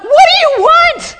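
high, drawn-out yell; startled confrontational shout. Retold or imitated. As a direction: shout it at full force at a pursuer. Retold confrontation with pursuer.

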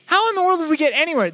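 excited rhetorical repeat; emphatic repeat. Real, not acted. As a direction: fling the question up high in mock disbelief.

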